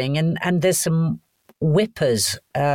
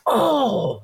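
high, drawn-out exclamation of shock; 8 semitones higher than usual. Retold or imitated. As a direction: imitate your own shocked cry, pitched up. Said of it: "and me going".

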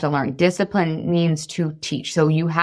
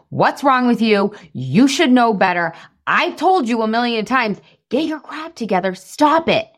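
exasperated scolding, climbing higher; mock parental yelling; 5 semitones higher than usual. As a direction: tell a child off, pitch rising to the end. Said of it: "I'm not yelling at him".

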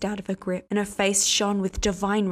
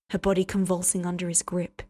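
plain reading voice

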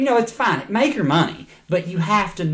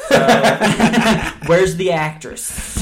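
laughing mock lecher; 5 semitones higher, 6 dB louder, slower than usual. Mock method actor.

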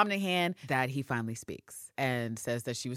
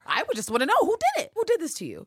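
high, loud, fast, falsely sweet; high sing-song mock concern; mock sweet curiosity; 14 semitones higher, 6 dB louder, faster than usual. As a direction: ask like a syrupy fake-friendly interrogator. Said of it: "when she wants to know".

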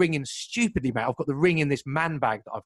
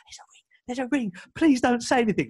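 a whispered start, then a tense, higher plea; desperate hushed plea; 9 semitones higher than usual. Retold or imitated. To the security guard, a hushed, pleading whisper. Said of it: "Like, properly, like".

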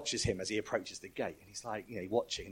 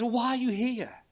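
much higher, exasperated question; baffled supervisor angel; 13 semitones higher, 6 dB louder than usual. Retold or imitated. Pastor voicing the puzzled angel, high and exasperated. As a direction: throw up your hands and ask. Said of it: "he goes".

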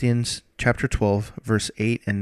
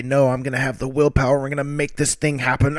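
quick, higher, puffed-up resolve; mock determination; 3 semitones higher, faster than usual. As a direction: ham up a stubborn self-reliant believer. Mocking self-reliant willpower talk.

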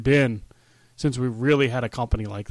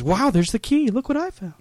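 higher-pitched mock wonder; mock amazement; 9 semitones higher than usual. Host mocking a wrestler finding the key, mock amazement. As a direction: feign a lucky discovery.

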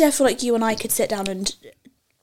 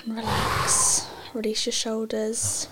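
guided meditation; 6 dB quieter than usual. Acted. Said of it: "Take a deep breath in".

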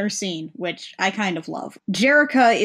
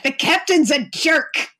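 higher-pitched angry retort; Pizzazz; 7 semitones higher than usual. Retold or imitated. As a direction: fire back in a mock-indignant outburst. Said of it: "Pizzazz immediately fires back".

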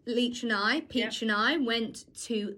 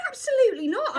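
high, loud, indignant retort; raised-pitch, emphatic retold annoyance; annoyed refusal; 10 semitones higher, 5 dB louder than usual. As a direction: re-enact a firm refusal with outrage. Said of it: "And I was annoyed, actually".

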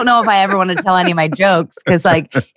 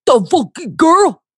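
slow, drawn-out higher Delco accent, imitating hecklers; mock Delco accent; 10 semitones higher, slower than usual. Comic mimicking Midwest hecklers in Delco accent. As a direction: sneer it like a Delco local. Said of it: "they sound like they're from Delco".

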